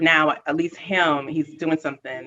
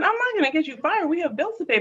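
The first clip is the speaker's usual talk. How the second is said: much higher, earnest reassurance; pleading to husband; 14 semitones higher than usual. Retold or imitated. Wife to husband about the meeting, high pleading. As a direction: reassure him with a raised voice. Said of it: "I said".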